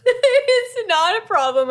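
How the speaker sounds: slow, high through helpless laughter; squeezing words out between laughs; hysterical laugh. Real, not acted. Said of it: "I'm crying".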